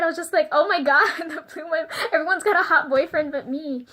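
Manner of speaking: excited gushing. Retold or imitated. Gushing to viewers about his hot boyfriend, excited. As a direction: squeal it like a jealous fan.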